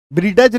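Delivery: ब्रीडज split as ब्री-डज; wrong pronunciation. Shown as a demonstration. Teacher warns against this reading, wrong pronunciation. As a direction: pronounce the D in ब्रीडज. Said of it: "नहीं पढ़ेंगे".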